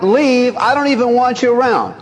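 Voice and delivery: high, pointed, stern dismissal; sharp dismissal. Retold or imitated. Jesus dismisses Peter sharply, high stern dismissal. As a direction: send him away like you mean it. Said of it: "Jesus answered Peter so sharply".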